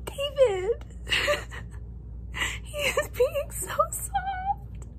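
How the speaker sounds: high, choked-up fan sob; overwhelmed happy crying; teary squeal. Real, not acted. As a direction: gush through happy tears. Overwhelmed fan reacting while reading.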